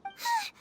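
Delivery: a sharp, high intake of breath; shocked gasp. Acted. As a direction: caught off guard by bad news. Shock at Nahida's capture.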